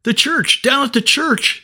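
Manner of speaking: put-on scared little boy gasping for help; panicked boy crying out. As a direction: cry out to your big brother in terror. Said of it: "he cried out".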